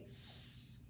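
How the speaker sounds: "S," as a long unvoiced hiss. Shown as a demonstration. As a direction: hold the "S," hiss steadily. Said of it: "for as long as you can".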